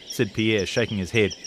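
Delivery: ordinary delivery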